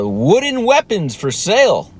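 high-pitched imitation of a mom reading aloud; mom reading sign. Neighbor mom reads son's sign, high put-on reading. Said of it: "And the mom reads the sign".

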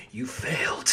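whispered quiet verdict; mock whisper. Customer condemning the clerk, quiet whisper. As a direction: hiss it out coldly.

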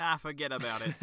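a quick mock New York "forget about it"; jokey New York accent tag line. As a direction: toss it off like a New York wise guy. Echoing the New York accent joke.

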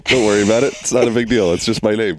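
loud, high, laughing mock offense. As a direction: act offended through laughter. Laughing mock indignation at name.

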